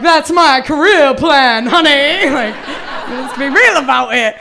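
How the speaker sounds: sassy retort. Retold or imitated.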